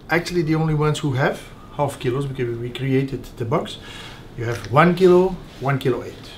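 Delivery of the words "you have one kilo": normal talking voice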